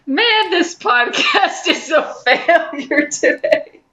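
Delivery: half-laughing, half-crying lament; laughing mock despair over a failing show. Real, not acted. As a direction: groan it through laughter.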